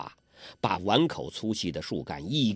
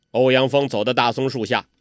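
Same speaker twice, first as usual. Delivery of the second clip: usual speaking voice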